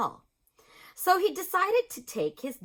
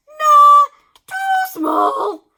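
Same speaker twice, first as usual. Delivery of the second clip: very high loud nah; dismissive doctor; 15 dB louder, much slower than usual. A narrator's reading. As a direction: cry out high with disdain.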